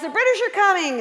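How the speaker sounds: loud, high rallying yell; yelled call. Retold or imitated. Paul Revere riding out shouting to everyone, loud and high. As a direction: shout the alarm from horseback. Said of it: "and he yells".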